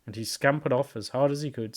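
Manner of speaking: ordinary delivery, nothing marked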